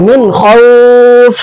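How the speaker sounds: মিন খাউফ recited with a held, stopped final consonant; stopped form. Shown as a demonstration. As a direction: hold the final sound, slowly, ending on a silent stop. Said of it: "আমি যখন ওয়াকফ করছি, তখন কি পড়ছি?".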